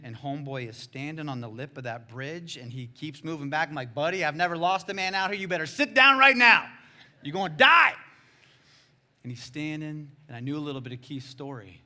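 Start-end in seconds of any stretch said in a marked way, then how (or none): 3.9-7.9 raised voice building to a high, loud order; urgent warning. Retold or imitated. Guide warns man standing on lip of bridge, urgent shout. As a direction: shout him back from the edge.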